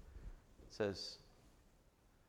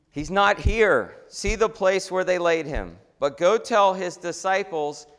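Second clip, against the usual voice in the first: slow, loud, higher proclamation; angel's announcement; 7 semitones higher, 9 dB louder, slower than usual. Acted. The angel tells the women at the tomb, slow and loud.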